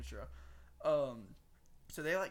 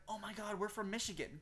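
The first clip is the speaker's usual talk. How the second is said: higher-pitched imitated girly gushing; mock excited girls; 6 semitones higher than usual. Girls hearing he's from Texas, mock-excited higher voice. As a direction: mimic girls thrilled at a coincidence.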